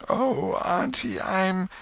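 very high, drawn-out moan; pitiful, hammed-up invalid; feigned suffering. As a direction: whimper slowly for sympathy. Tom moans to aunt, cut off.

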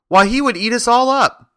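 very high, loud, alarmed squeak; alarmed cry. A narrator's reading. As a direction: protest in fright as a little mouse queen. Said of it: "cried the little Queen".

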